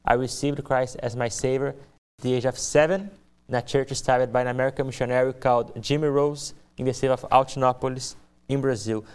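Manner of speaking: ordinary talk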